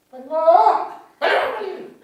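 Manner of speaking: high, urgent mock mom yelling; a mother's shrill-pitched "come quickly" call; impatient mother calling. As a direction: hurry the child along like a fed-up mother.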